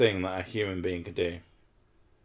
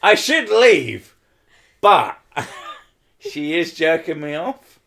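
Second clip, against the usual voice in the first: mock inner dilemma; 4 semitones higher, 8 dB louder than usual. Telling the stream his thoughts, high and hammed-up. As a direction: play it as comic indecision. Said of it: "I was like".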